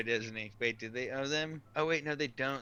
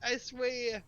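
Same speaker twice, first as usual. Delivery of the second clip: high, slow put-on accent; a high, slow mock accent for Sonny; Sonny's voice; 11 semitones higher, 6 dB louder, slower than usual. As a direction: mimic a buddy's accent, hammed up. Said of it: "now I'm doing Sonny's voice".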